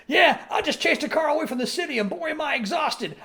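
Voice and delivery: high puppet voice, mildly puffed and proud; Rolf bragging. Retold or imitated. Rolf tells the gang he chased a car off, high puppet voice. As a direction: brag about chasing the car, lightly voiced. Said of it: "He's like".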